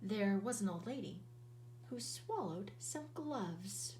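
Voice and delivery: plain reading voice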